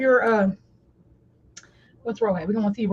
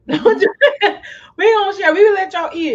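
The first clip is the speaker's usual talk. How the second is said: laughing; 7 semitones higher, 5 dB louder, much slower than usual. Real, not acted. Laughing while refusing food.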